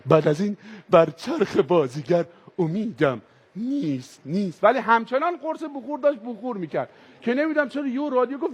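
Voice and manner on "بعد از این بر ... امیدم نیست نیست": deep, slow recited verse from the car radio; radio reciting poetry. Retold or imitated. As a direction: read it like an old radio poet, low and slow. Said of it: "ماشین گفت".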